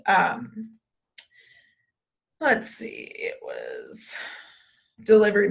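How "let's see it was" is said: whispered aside while searching notes; whispering to herself. Real, not acted. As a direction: breathe it under your breath. Muttering while pulling up document.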